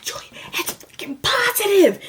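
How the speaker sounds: breathless high whisper into the phone; frantic whisper. Retold or imitated. Telling her boyfriend the test result, high frantic whisper. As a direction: hiss it out in disbelief.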